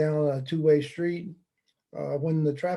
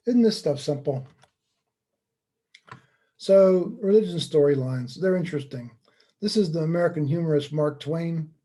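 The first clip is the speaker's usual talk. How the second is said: ordinary delivery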